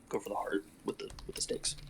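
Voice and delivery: fading into a whisper. Acted. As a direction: drop to a whisper. Urgent instructions in hiding.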